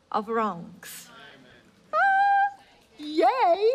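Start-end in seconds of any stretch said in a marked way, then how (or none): 1.9-3.7 very high delighted squeal; joyful squeal. Acted. God squealing with joy over his beloved child.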